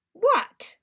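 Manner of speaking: high, loud sharp what; wary what. Acted. Lupin eyeing Sirius warily, sharp. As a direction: snap it back suspiciously.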